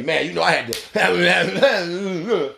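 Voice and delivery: slow, drawn-out guilty excuse; sheepish excuse. Retold or imitated. Visitor confessing in your house, slow and drawn out. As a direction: stretch it out like a guy owning up. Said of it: "tell you".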